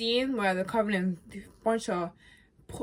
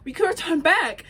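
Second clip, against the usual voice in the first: higher, louder angry reproach; exasperated Ellie; 9 semitones higher, 8 dB louder than usual. Retold or imitated. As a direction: blame her with a raised voice. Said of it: "Ellie's like".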